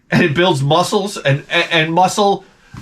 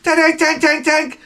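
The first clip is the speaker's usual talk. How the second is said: high, bouncy clatter of a die; die bouncing sound; 12 semitones higher, slower than usual. Retold or imitated. Comic imitating dice hitting the floor, high bouncing tanks. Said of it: "the die go".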